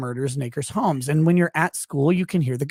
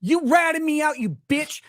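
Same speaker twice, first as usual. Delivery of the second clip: mock furious rant; 10 semitones higher than usual. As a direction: yell at Calvin in fake fury.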